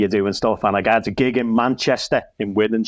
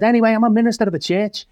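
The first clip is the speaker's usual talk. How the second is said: retold blurt; 11 semitones higher, faster than usual. Comic telling the pub crowd he is a minister, high and fast.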